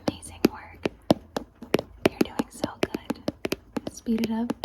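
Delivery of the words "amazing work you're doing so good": ASMR whisper. Acted. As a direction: whisper praise close and gentle.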